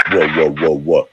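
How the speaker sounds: low, pulsing whoa sound; deep sound. Shown as a demonstration. Coach demonstrating to Cindy, deep pulsing whoa. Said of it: "That's a deep sound".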